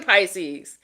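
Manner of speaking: ordinary talk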